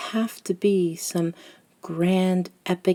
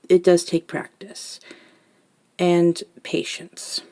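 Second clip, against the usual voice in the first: usual speaking voice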